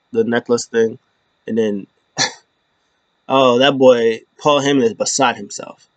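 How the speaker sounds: ordinary talk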